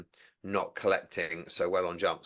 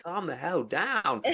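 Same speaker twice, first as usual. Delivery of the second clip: slow, drawn-out, higher "calm the hell down"; 8 semitones higher, much slower than usual. Retold or imitated. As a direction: stretch every word to settle the dog. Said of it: "I'd be like".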